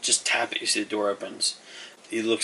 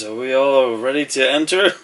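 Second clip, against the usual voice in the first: louder, raised-pitch greeter voice; welcoming Steve; 4 semitones higher, 7 dB louder than usual. Acted. Shopkeeper greets the arriving party, raised welcoming voice. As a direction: call it out like a welcoming host. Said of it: "Steve has his arms out. He goes".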